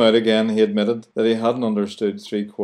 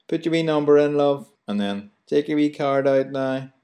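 put-on higher, cheery Belfast till girl; Belfast shop girl; 5 semitones higher than usual. Host imitating garage girl to listeners, higher cheery Belfast voice. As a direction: chirp it like a friendly garage cashier. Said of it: "the wee girl will always say".

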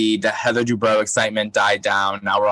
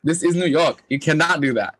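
impression of my mom; 7 semitones higher than usual. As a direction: scold like an annoyed mom. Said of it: "my impression of my mom".

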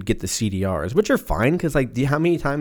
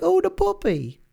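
very high, delighted squeal; excited puppy voice. Real, not acted. Distracted by a dog outside, high delighted coo. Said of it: "and then I'm like".

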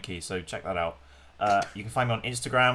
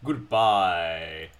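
deep voice; 6 semitones lower than usual. Pretend. Deep mock farewell.

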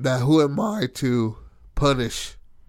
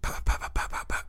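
punch sounds; 4 dB quieter, faster than usual. Retold or imitated.

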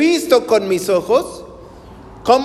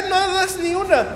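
mock exasperation; 9 semitones higher than usual. Preacher mocks his tired self.

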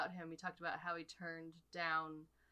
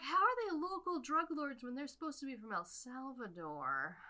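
higher, puzzled mock question; baffled question; 7 semitones higher than usual. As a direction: ask it as if truly baffled. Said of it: "Except I'm like".

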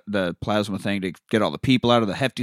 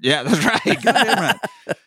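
laughing; 9 semitones higher, slower than usual. Real, not acted. Laughing agreement with cohost.

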